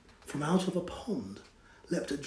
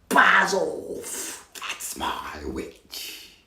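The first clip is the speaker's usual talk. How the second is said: horrible beast voice; much slower than usual. Acted. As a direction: rasp it like a swamp beast. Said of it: "a yowl and a growl and a croak".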